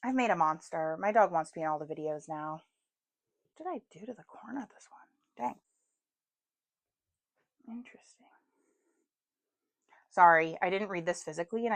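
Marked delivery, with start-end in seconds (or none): 3.6-5.6 fast, breathy whisper to herself. Real, not acted. Whispering while handling book. 7.7-8.3 hushed whisper to herself. Real, not acted. Whispering over book pages.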